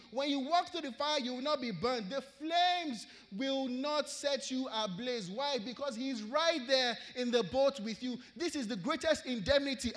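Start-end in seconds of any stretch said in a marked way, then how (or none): 0.1-5.3 louder, building, then slowing on the last line; preaching scripture. Acted. Preacher proclaiming Isaiah to the congregation, building force. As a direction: proclaim the promise with rising force.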